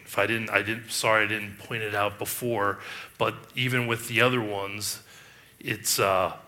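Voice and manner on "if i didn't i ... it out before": ordinary talk, nothing marked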